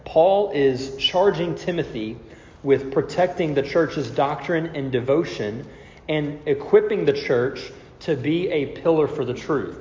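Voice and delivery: normal talking voice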